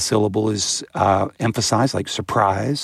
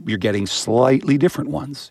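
normal talking voice

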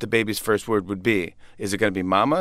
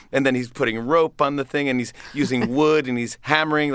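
usual speaking voice

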